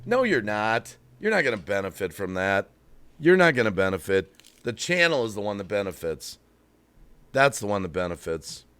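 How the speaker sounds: low, soft, matter-of-fact contradiction; deadpan debunking. Real, not acted. Streamer correcting the sales pitch for viewers, low deadpan rebuttal. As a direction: drop right down and puncture the hype. Deflating reply to the mocked pitch.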